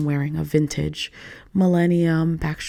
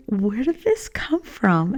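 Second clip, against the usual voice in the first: puzzled; 4 semitones higher, slower than usual. Retold or imitated. Puzzled self-question voiced.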